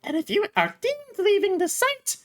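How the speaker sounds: high, menacing warden. Acted. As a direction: squeak out the threat up high. Warden explains collar.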